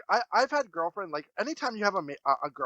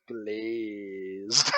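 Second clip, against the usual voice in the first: put-on quoting girlfriend; 10 semitones lower, 4 dB quieter than usual. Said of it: "smiles and goes".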